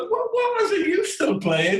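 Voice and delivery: mock shock at the dig; incredulous reply. Striker retorting to the veteran, incredulous. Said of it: "I went".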